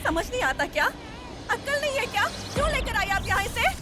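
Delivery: high, fast angry rebuke; angry scolding. Acted. Angry mother rebuking the girl for taking the baby. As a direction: scold her sharply.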